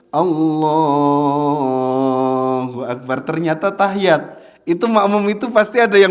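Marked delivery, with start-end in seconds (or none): 0.1-3.2 "Allahu" stretched long and slow; drawn-out takbir. Shown as a demonstration. Teacher shows the overlong takbir that misleads the worshippers. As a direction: drag out the Allah. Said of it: "Soalnya kalau dipanjangin".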